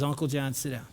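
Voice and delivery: low, soft instruction before big news; hushed acted-out nephew on a call; quiet lead-up. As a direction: tell him gently, holding the news back. Nephew's phone news, retold quietly.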